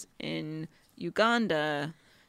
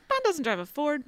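indignant quote; 8 semitones higher, faster than usual. Retold or imitated. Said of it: "I was like".

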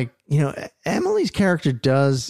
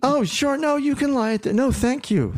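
high-pitched, flustered imitation of polite awkwardness; awkward polite refusal; 13 semitones higher than usual. Host mimicking the awkward couple in the scene, high and over-polite.

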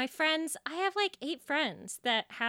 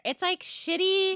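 higher, louder, slow put-on accent; Long Island accent; 10 semitones higher, 6 dB louder, slower than usual. Shown as a demonstration. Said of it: "I wanna put an R in there somewhere".

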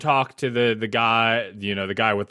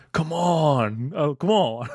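stage whisper; 4 semitones higher, slower than usual. Retold or imitated. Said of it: "stage whisper".

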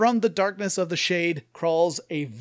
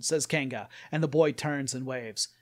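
usual speaking voice

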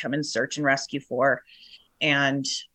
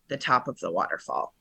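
usual speaking voice

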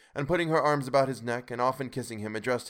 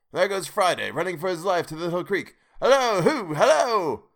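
much higher, louder hallooing; calling out; 7 semitones higher, 6 dB louder than usual. A narrator's reading. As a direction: call across to Friday running off. Scrooge calls out to Friday.

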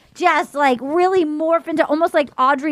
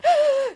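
sharp, high mock gasp; hammed-up gasp before sobbing; imitated tearful gasp; 12 semitones higher than usual. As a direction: suck in air like someone about to cry. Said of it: "And then Elizabeth is like".

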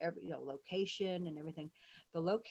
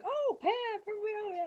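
high imitation of Laura; 3 dB louder than usual. Imitating Laura greeting her.

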